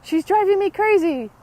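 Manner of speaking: high, loud, exasperated outburst; a husband at his wits' end, blurting it out loud; frantic complaining husband. Retold or imitated. Husband complaining to the yogi about his wife, loud and frantic. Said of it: "and he said".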